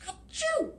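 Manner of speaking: loud high achoo sneeze. Acted. Loris sneezes from her cat allergy, high achoo. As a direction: sneeze big for the kids.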